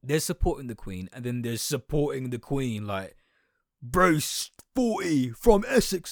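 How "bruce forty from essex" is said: loud, slow, high mocking bellow; hammed-up slow shout; mock royalist. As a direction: boom out the name like a mock flag-waver. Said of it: "royalist flag shagger who is like".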